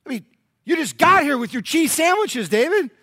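high, loud, disbelieving; can you believe this guy; incredulous. Retold or imitated. Soldiers of Israel telling David about Goliath, high incredulous exclaim. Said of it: "the men of Israel said".

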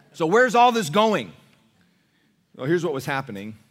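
normal talking voice

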